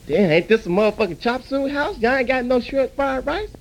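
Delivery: ordinary talk, nothing marked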